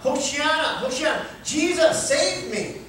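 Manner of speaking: high, loud pleading cry. Retold or imitated. Preacher voicing his own cry to Jesus, loud plea. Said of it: "continue to cry out".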